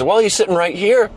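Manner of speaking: high, playful voice; spring the surprise on him; imitating a smug Irishman, lightly; teasing reveal. Irish guy revealing Daniel.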